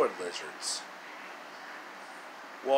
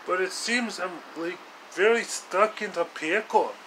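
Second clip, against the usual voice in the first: higher put-on hero voice, rescuer apologizing; hero Yoshi voice; 5 semitones higher than usual. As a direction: play the earnest rescuer who came too late. Yoshi in the prison cell.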